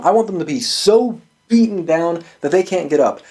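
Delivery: usual speaking voice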